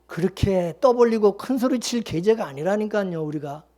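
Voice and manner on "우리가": ordinary talk, nothing marked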